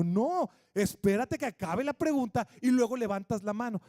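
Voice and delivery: loud, firm wait-your-turn; a father telling his kids to let him finish; firm dad. Retold or imitated.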